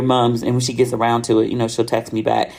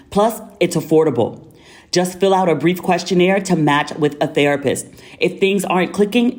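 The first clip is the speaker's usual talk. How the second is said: higher, polished ad-read delivery; higher-pitched, neatly articulated promo read; sponsor ad read; 5 semitones higher than usual. Acted. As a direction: read it like a podcast sponsor spot.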